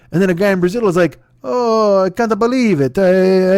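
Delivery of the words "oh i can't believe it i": mock Brazilian accent. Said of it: "I don't know why I have this accent".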